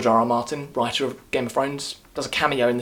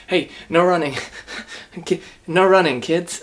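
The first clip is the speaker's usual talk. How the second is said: mock lifeguard telling off; 5 semitones higher than usual. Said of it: "goes like".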